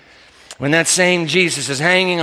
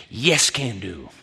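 lower, slower, softer confident reply; firm insistence; 9 semitones lower, 5 dB quieter, slower than usual. Retold or imitated. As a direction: settle it calmly and surely. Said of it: "two of them insist".

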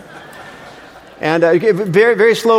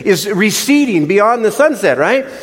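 higher, exasperated storytelling; irritated; 6 semitones higher, 3 dB louder than usual. Retold or imitated. Husband reliving the breakdowns, exasperated. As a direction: let the irritation rise as the car dies again. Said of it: "I am getting irritated".

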